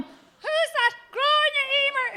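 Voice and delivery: put-on high, frail elderly mum; old mother voice. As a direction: play the deaf old mother. Mother answering phone, voiced.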